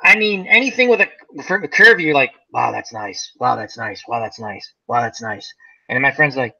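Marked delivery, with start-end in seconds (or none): 2.5-5.5 low soft repeated wow, that's nice; dazed awe. Retold or imitated. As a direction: mumble it like a lovestruck teen in a daze. Smitten teen ogling curves.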